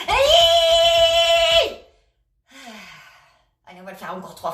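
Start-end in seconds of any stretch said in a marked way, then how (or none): 0.1-1.7 long, high, loud squeal; child's tantrum scream. Shown as a demonstration. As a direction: shriek like a child throwing a tantrum. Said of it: "comme un enfant qui fait un caprice".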